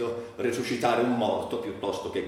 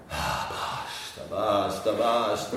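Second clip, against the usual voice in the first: exasperated outburst; 6 semitones lower, slower than usual. Acted. Furious outburst against his tormentors.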